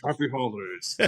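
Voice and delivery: grudging greeting, teeth clenched; through gritted teeth. Pretend. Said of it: "I'm gritting my teeth while I say this".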